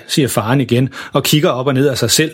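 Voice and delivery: ordinary delivery, nothing marked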